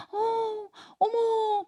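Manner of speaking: very high, soft cooing; touched gasp. Retold or imitated. Studio audience cooing at crying child, high soft sympathy.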